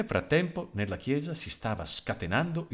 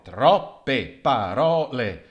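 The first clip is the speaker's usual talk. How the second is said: gossip's verdict; 8 dB louder, much slower than usual. Acted. Gossip repeats.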